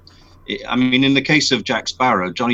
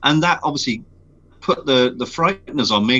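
usual speaking voice

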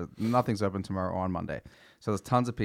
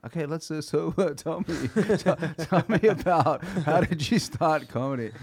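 mock interviewer; 7 semitones higher, 4 dB louder than usual. Mock redo of podcast interview.

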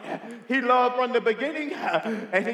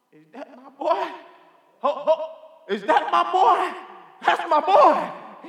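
rising, louder, slowing with delight; joyful father recognizing his son; 5 semitones higher, 6 dB louder, slower than usual. Acted. Father seeing his returning son, overjoyed. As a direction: call out in disbelief and joy, higher and louder.

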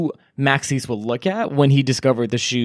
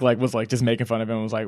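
normal talking voice

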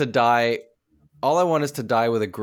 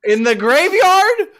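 mock shriek; 11 dB louder, slower than usual. Mocking joke, squealed.

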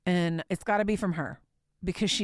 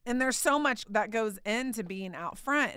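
ordinary delivery

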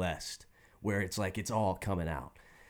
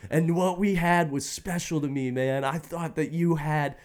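higher, crying teen rant, hammed up; tearful rant; 7 semitones higher than usual. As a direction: replay the tearful teenage outburst, pitched up. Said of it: "So I'm crying".

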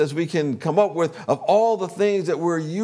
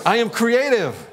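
higher and louder, proclaiming each word; leading affirmation, raised; 5 semitones higher, 3 dB louder than usual. Real, not acted. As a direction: call it out for the room to echo. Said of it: "you can affirm with me".

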